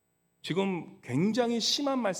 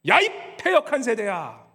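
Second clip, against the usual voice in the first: louder, shouted rebuke; furious shout; 4 semitones higher, 7 dB louder than usual. Acted. Jesus shouting at the faithless generation, angry. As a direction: yell the rebuke at full force. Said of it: "그 모습을 보면서 주님께서는".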